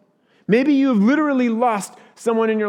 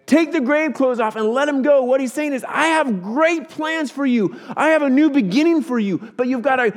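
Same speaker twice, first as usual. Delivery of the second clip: higher-pitched, emphatic pulpit appeal; impassioned preaching; 6 semitones higher, 4 dB louder than usual. Acted. Preacher voicing Jesus calling listeners out of the tomb, impassioned preaching.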